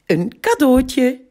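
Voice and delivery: put-on high, slow proud girl's voice; happy child. A narrator's reading. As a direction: present the gift proudly. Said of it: "lacht Anna".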